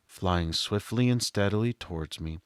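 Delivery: plain reading voice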